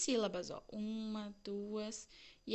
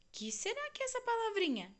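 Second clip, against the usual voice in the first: playful quiz; 11 semitones higher than usual. Acted. Teacher quizzing children.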